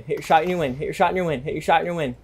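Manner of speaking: ordinary delivery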